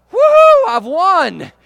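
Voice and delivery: put-on loud, high childish victory cry; triumphant whoop. Boy whooping at his brother's scolding, loud and gleeful. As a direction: whoop like a kid who just won.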